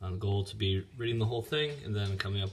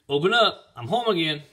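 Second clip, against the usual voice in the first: loud, high holler to the house; hearty raised-voice announcement; shouting; 8 semitones higher, 8 dB louder than usual. A narrator's reading. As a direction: call out so they hear inside. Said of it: "he shouted".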